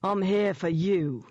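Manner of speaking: slow, taunting London thug voice; mocking menace. A narrator's reading. London demon tells heroine she is his target, mocking. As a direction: taunt slowly with a London accent. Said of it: "it mocked".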